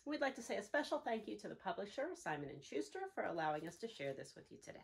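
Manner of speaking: usual speaking voice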